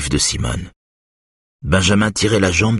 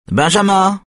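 high, loud call across the house; 4 dB louder than usual. A narrator's reading. Mother calling her son from the kitchen, loud and high. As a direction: shout the boy's name to fetch him. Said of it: "cria-t-elle".

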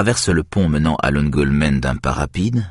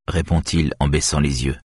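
usual speaking voice

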